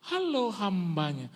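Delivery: high, slow drawn-out hello; mock motherly greeting; imitated doting mother.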